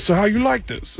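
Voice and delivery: high imitation of a casual question; Tracy impression. Retold Tracy at the bar.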